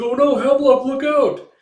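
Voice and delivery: high, loud cry of warning; warning shout. Retold or imitated. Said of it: "He's like".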